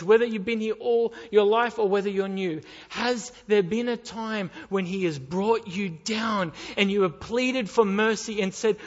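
loud, pleading fire-and-brimstone preaching; full-force preaching. Acted. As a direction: press the congregation hard, loud.

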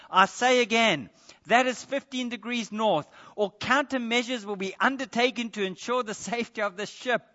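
loud, high, blustering naval ultimatum; furious captain. Retold or imitated. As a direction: shout the threat like a captain who thinks he's won. Captain threatens with his fleet.

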